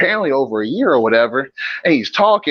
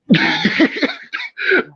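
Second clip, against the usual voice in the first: a burst of high-pitched laughter; shrill cackle of a laugh; high cackling laugh; 14 semitones higher than usual. Real, not acted. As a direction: crack up at his own joke. Laughing at his own roast.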